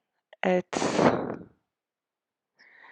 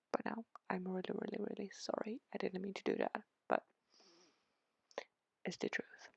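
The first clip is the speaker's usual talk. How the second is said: very soft, near-whispered apologetic aside; hushed apology; 2 semitones higher, 14 dB quieter, much faster than usual. Real, not acted. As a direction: drop right down to a sheepish murmur. Hushed mock apology to fellow Swedes.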